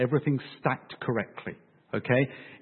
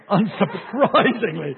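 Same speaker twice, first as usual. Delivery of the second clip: slow, drawn out into a laugh; laughing, tongue-in-cheek delivery; amused sarcasm; 4 semitones higher, 4 dB louder, slower than usual. Real, not acted. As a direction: stretch the word and break into laughter. Joking at audience's mock surprise.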